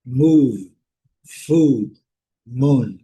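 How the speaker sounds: a long U in each word. Shown as a demonstration. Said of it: "um som longo".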